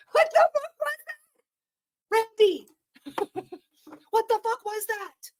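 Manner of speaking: high, incredulous cry of disbelief; shocked disbelief. Real, not acted. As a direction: react as if you can't believe what you saw. Shocked reaction to clip.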